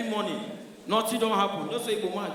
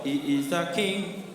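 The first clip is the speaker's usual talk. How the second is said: reverent introduction; 4 dB quieter, slower than usual. Retold or imitated. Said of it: "hear the way they introduce God".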